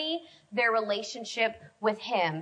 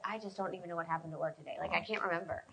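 much softer, quick, lower dazed mumble; dazed murmur; 7 semitones lower, 13 dB quieter, faster than usual. Retold or imitated. Young Dan after work, dazed and soft. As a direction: sound lovestruck and spaced out. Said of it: "he would come back and be like".

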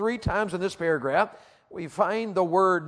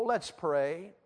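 ordinary talk, nothing marked

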